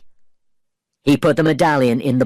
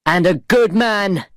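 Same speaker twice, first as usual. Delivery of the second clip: shouted defense; 7 semitones higher than usual. Acted. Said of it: "Will shouted".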